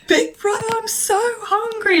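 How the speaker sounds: high, drawn-out hungry whine; whiny plea to the diary room; plaintive plea. Retold or imitated. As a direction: beg like a starving child. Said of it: "like, into my microphone".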